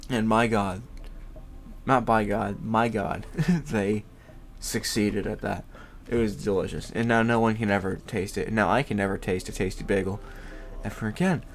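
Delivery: ordinary delivery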